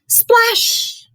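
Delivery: high squeaky splash; splash sound effect. Acted. Narrator for kids, a high playful splash. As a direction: make the splash pop, high and bright. Boy falls into well.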